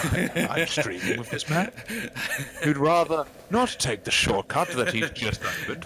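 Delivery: mock exasperation. Mock complaint about friend.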